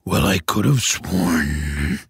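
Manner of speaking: low, slow, soft ogre mutter. Acted. The ogre puzzling over the missing boy, low slow mutter. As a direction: grumble it under the breath. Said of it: "he would mutter".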